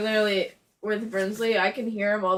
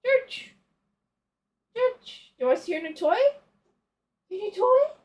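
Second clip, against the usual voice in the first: calling out to cat; 12 semitones higher, 8 dB louder than usual. Real, not acted.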